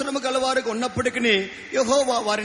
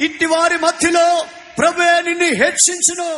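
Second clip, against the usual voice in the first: preaching at full force; 4 semitones higher, 5 dB louder than usual. Acted.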